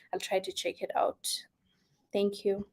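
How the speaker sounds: soft unvoiced whisper; gentle whispered sign-off; whispered thanks. Real, not acted. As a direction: whisper the thanks as a quiet aside.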